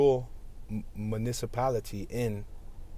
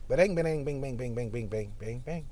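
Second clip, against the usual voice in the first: beatboxing bangs. Acted. Making beats over a dirt bike ride, fading bangs. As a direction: beat out the bangs, then let them fade. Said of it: "That's me making beats with my dirt bike".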